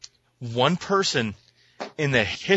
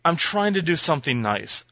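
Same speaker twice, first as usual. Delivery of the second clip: ordinary delivery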